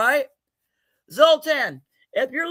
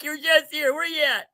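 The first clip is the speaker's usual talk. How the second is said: ordinary talk, nothing marked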